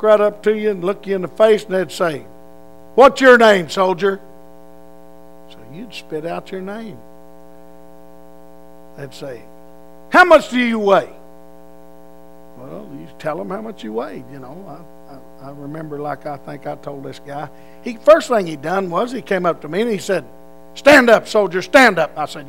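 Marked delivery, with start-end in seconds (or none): 3.0-4.2 drill sergeant. Retold or imitated. 10.1-11.1 drill sergeant. Retold or imitated. 20.7-22.2 a sergeant barking at a recruit to stand; drill sergeant barking. Retold or imitated.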